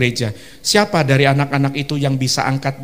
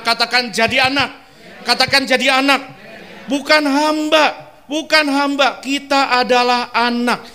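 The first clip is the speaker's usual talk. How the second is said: fiery pulpit delivery; full-force preaching; 7 semitones higher than usual. Acted. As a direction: drive it home to the congregation.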